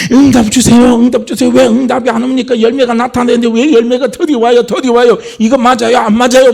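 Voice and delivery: loud, high impatient pleading; impatient demanding. Retold or imitated. Believer impatiently demands God's answer, loud pleading. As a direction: press God like someone who can't wait.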